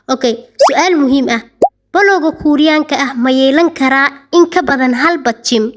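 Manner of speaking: usual speaking voice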